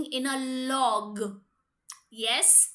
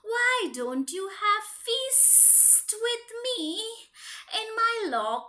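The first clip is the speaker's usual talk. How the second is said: sly snake; slower than usual. Acted. Snake slowly lures the mouse to his log pile. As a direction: draw it out like a hungry snake.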